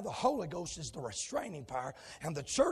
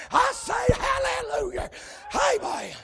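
ordinary talk

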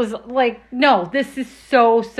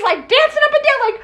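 ordinary delivery

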